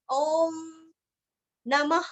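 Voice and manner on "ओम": ओम drawn out long; दीर्घ ओम. Shown as a demonstration. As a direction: hold the vowel of ओम. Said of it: "ओम का उच्चारण दीर्घ करना है".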